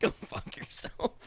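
hushed, breathy go fuck yourself, half joking; whispered retort. Real, not acted. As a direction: breathe it out under your breath, no voice. Teasing retort to a friend.

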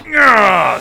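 loud high scornful nah; mock dismissive nah. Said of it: "she's just like".

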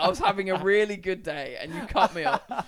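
laughing plea. Retold or imitated. Pleading with biker, laughing.

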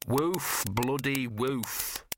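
slow, grudging dog voice saying woof; a dog dryly pronouncing the word woof; talking dog. Acted. Gaspode to Victor, a sarcastic talking dog. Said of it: "said Gaspode the Wonder Dog".

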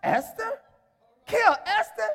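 high, questioning incredulity; stunned disbelief. Acted. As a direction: act a king who cannot believe it. King stunned at threat to queen.

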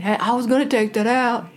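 exaggerated mock husband voice; Fixer Jeff. Wife imitating her husband's protest about the trash, mock voice. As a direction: put on a teasing imitation of an eager fixer. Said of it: "here comes Fixer Jeff saying".

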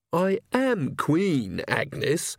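wounded royal dignity, stretched words; reproachful. A narrator's reading. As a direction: draw it out as a dignified rebuke. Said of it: "said Magrat reproachfully".

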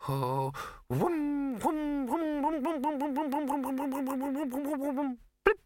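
high, accelerating humming wum-wum ending in a pop; imitated machine noise. A narrator's reading. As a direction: hum a rising throb, then pop it. Maid imitates the pot's noise.

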